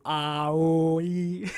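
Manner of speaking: slow, drawn-out "Ah, oh, ee"; mirror vowel drill. Shown as a demonstration. Cajun guest confessing his mirror practice, slow drawn-out vowel drill. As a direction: hold each vowel in "Ah, oh, ee" like a diction exercise. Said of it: "I do stand in the mirror and go".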